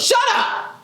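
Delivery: sudden high shout of shut up; mock snap. Speaker snapping at the teacher to shut up, high shout.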